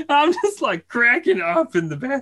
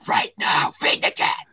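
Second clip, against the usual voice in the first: stern whispered order. Retold or imitated. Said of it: "totally yelled the junk out of him".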